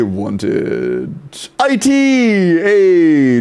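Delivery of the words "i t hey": high, excited cheer; real burst of enthusiasm. Real, not acted. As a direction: cry it out high and stretched, thrilled. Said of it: "said it with so much enthusiasm".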